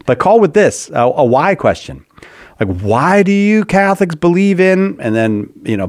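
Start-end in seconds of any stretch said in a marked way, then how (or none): 2.8-5.0 slow, raised, grumpy challenge; mad. Shown as a demonstration. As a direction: ask like an annoyed caller. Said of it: "I'm saying it like you're mad at us".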